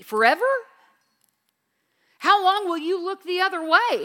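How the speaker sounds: desperate psalm lament; anguished plea. Acted. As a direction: read it as David crying out in anguish.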